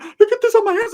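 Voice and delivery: high-pitched screaming character, very loud; Ricky's outraged shriek. Acted. Ricky screaming after the fight.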